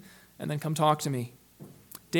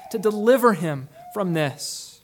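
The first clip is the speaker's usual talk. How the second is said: ordinary talk, nothing marked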